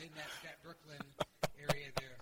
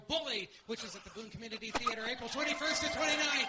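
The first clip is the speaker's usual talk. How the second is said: hammed-up announcer plug, building to a shout; excited mock promo, climbing to a yell; show plug; 4 semitones higher, 7 dB louder than usual. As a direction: plug it high and loud, rising as you go.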